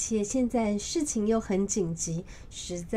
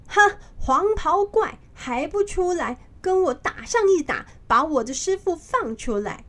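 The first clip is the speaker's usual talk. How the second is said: shouting challenge; 7 semitones higher, 5 dB louder than usual. A narrator's reading. Monkey King shouting at the palace for the Yellow Robe Demon, loud. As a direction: bellow a challenge at the palace gate. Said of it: "对着皇宫大喊着".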